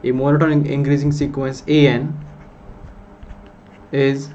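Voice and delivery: slow, deliberate dictation of a theorem. Acted. Teacher dictates the theorem to students, slow dictation. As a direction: spell it out word by word for notes.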